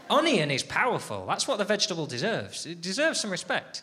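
ordinary talk, nothing marked

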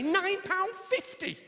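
high, slow, stretched price; outraged disbelief. Acted. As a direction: drag it out in scandalised disbelief.

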